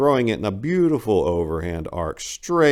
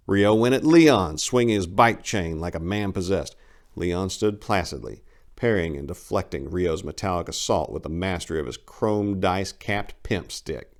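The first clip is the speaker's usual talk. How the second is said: ordinary delivery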